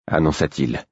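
ordinary delivery, nothing marked

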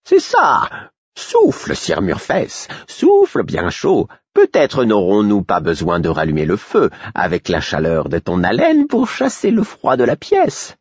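taunting jester egging someone on; mocking fool. Fool jeering at Murfess by the hearth, mocking.

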